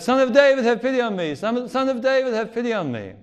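high, loud desperate plea; crying out. Retold or imitated. Two blind men following Jesus at a distance, loud pleading cry. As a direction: call out after someone far ahead. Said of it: "They're crying out".

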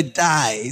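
die said with an Australian accent, like "die"; Australian-style "die" for day. Shown as a demonstration. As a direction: say "die" with a broad Australian vowel. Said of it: "you say".

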